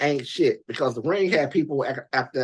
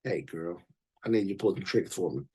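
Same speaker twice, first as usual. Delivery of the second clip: low, soft pimp sweet-talk; 8 semitones lower, 7 dB quieter than usual. Retold or imitated. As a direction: croon it low like a pimp giving orders. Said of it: "that ring was talking to everybody".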